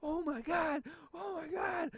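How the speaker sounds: very high mock shriek of horror; imitated flipping out. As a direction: scream like onlookers losing it. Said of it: "hear 'em flipping out".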